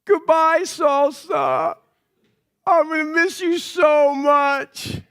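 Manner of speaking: dad teasing his daughter with an overblown goodbye; mock tearful goodbye. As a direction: ham up a heartbroken goodbye to the toddler. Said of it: "and I said".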